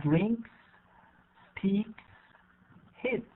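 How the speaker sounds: "drinks, speaks, hits" one by one, each ending in a hissed "s"; voiceless S ending. Shown as a demonstration. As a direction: end "drinks", "speaks" and "hits" on an unvoiced "s", never "z". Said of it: "We pronounce it like S".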